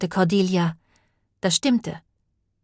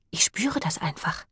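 hushed, slight tremble; understated quaver; trembling voice; 5 dB quieter than usual. A narrator's reading. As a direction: let a touch of tremble into a soft voice. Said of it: "Beths Stimme zitterte".

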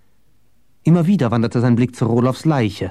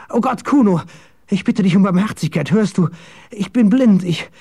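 high, loud, desperate pleading; terrified high-pitched begging; panicked begging; 10 semitones higher, 3 dB louder than usual. A narrator's reading. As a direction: plead frantically for your life. Blinded captive begs for mercy.

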